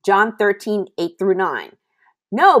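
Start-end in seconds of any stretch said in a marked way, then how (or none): 2.3-2.6 loud, high refusal; protesting. A narrator's reading. Peter to Jesus at the foot washing, protesting. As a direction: cry out in protest. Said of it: "Peter protested".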